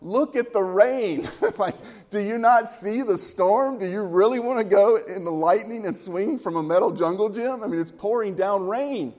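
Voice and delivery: exasperated dad. Retold or imitated. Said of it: "And I would be like".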